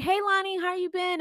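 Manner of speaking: high, sugary hello to a housemate; sweet greeting. Retold or imitated. Passing her room, greeting Lonnie in a sugary high voice. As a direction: chirp it like a bubbly neighbor. Fake friendly hallway greeting.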